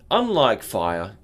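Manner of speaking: ordinary talk, nothing marked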